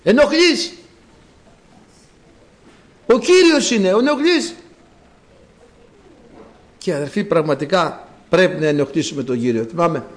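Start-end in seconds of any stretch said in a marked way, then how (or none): 0.0-4.6 raised, high-pitched reproach; acted scolding. Crowd rebukes a man bothering the Lord, loud high scolding. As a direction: scold as if shooing a nuisance. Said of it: "Τον μαλώνανε".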